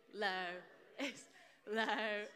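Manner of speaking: "Lois" split into LOH, pause, ISS; drawn-out "Lois", LOH... iss; say-along pronunciation. Shown as a demonstration. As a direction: lead a class through "Lois" as two separate syllables. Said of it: "Say it with me".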